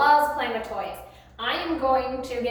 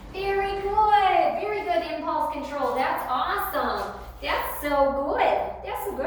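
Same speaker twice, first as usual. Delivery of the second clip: high praise; 6 semitones higher than usual. Real, not acted. Trainer praising Ernie for holding his stay, high gushing praise.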